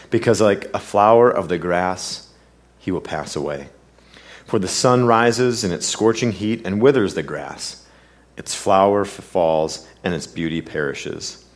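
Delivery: measured, solemn Bible reading; scripture reading. Acted. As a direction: read the passage aloud as if from the pulpit. Reads the epistle of James aloud.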